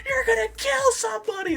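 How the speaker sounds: unload on the driver, hammed up; mimicked hysterical scolding shriek; imitated top-of-lungs scream. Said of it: "uncorked at the top of her lungs".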